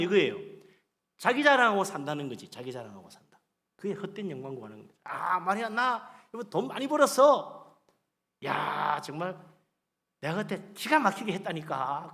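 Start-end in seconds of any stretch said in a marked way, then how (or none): 5.0-12.1 hammed-up self-satisfied bragging; mock boasting. Preacher imitates man bragging about money, mock boast. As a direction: act out a show-off, stretching the words. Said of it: "자기 자랑 막 하는 거야".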